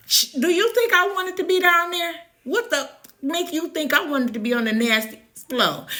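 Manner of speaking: high, fast outraged comeback; sharp indignant protest; indignant retort. Retold or imitated. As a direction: fire it back, offended and quick.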